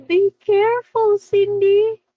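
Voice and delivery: gasp, then call out a mock warning; playful warning. Teacher warns Cindy in the lesson, loud and playful.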